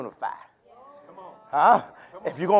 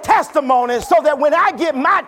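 loud, higher whooping sermon delivery; preaching at full force; 6 semitones higher, 5 dB louder than usual. Acted. Preacher rousing the congregation, full-force preaching. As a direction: preach it hard to the pews.

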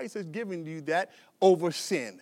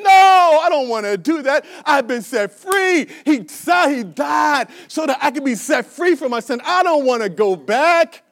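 shouted testimony; 5 semitones higher, 9 dB louder than usual. Acted.

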